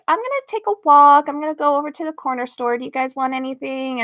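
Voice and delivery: fake cheerful. Fake-cheerful line to family.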